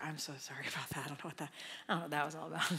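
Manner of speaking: rushed, embarrassed self-correction in prayer; flustered mock apology. Preacher mimics a distracted prayer, hurried and sheepish. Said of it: "shame yourself back to the performance prayer".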